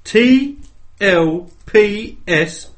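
high, loud winner announcement; triumphant ringside call; announcer shout. Acted. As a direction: spell it slowly, then yell the last word.

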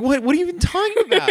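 very high, rushed mock-bewildered squeal; hammed-up teenage incredulity; flustered protest. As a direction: play a baffled kid protesting innocence. Retold bewildered protest to dad.

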